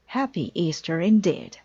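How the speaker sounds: usual speaking voice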